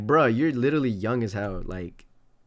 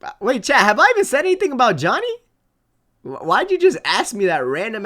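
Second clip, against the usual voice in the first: louder, higher, fast protest; agitated; 8 semitones higher, 7 dB louder than usual. Real, not acted. Streamer protesting to chat, loud fast disbelief. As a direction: fire back at chat in disbelief. Denies chat's claim, agitated.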